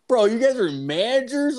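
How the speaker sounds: high, loud, disbelieving; shocked to find your buddies are the bosses; surprised question. Retold or imitated. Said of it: "I was like".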